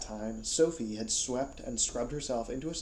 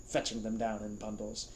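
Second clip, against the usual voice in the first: ordinary delivery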